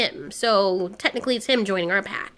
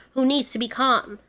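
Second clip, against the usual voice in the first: plain reading voice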